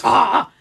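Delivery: loud irritated "ah"; acted shout of annoyance; exasperated cry. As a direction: burst out as if your shirt just snagged. Acted reaction to caught shirt.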